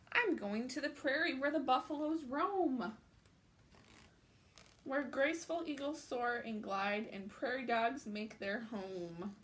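put-on animated children's book narration; picture book read-aloud. A narrator's reading. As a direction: read it out like storytime for little ones.

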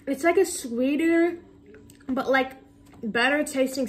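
words slightly muffled by food; talking while chewing. Real, not acted. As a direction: mouth partly full of candy. Seen 0: bit bar at -5, still holds it, mouth working while talking.

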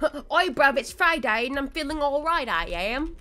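loud, cheeky fake British bruv; hammed-up British accent. Streamer voices a viewer feeling fine, loud fake British accent. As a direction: boom it out in mock British. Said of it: "that accent is so butch".

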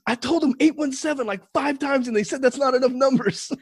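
imitating a nine-year-old's high-pitched fluster; loud, high, exasperated kid protest; panicked child. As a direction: plead your case like a frantic child. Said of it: "I know that panic".

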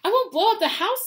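put-on high, loud child's protest; protesting boy. A narrator's reading. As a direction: pipe up indignantly as a young boy. Boy protests to aunt and uncle.

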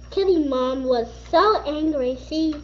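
ordinary delivery, nothing marked

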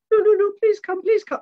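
very high, loud squeaky begging; pleading monkey. Acted. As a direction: beg like an insistent little puppet. Puppet monkey begs busy owner.

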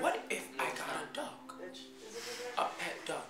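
hushed musing. Retold or imitated. Late night thought, dreamy.

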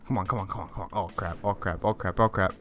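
soft tense muttering; quiet muttering. Real, not acted. Gamer fleeing pursuers, soft tense muttering. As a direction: mutter it under your breath.

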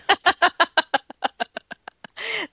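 long, high laugh; real high, drawn-out laughter. Real, not acted. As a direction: laugh helplessly at the impression. Laughing at the accent parody.

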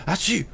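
high-pitched fake sneeze breaking in; acted sneeze. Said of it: "I start sneezing and wheezing".

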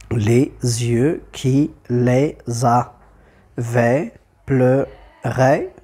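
very slow, detached syllables; deliberate counting-out with pauses between syllables. Shown as a demonstration. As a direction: scan the verse one syllable at a time. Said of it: "Et j'obtiens un, deux, trois".

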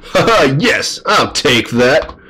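high cackling triumph; gloating dragon. Acted. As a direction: crow over your loot like a cartoon dragon. Dragon takes the gold.

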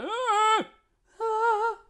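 high ringing vowel; high mixed tone. Shown as a demonstration. Coach compares voices for the student. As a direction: float a bright high note. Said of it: "See the different voices?".

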